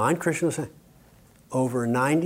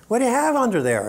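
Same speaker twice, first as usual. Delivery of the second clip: high, quick nosy question; high curious asker; 10 semitones higher, faster than usual. Retold or imitated. Nosy wedding guest asks about the kilt, high and quick. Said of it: "People would say".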